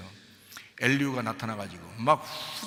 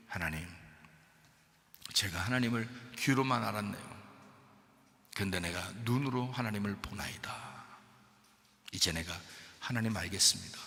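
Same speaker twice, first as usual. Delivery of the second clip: hushed, lower confession; humbled Job; 7 semitones lower, 8 dB quieter than usual. Acted. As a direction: confess softly, broken down.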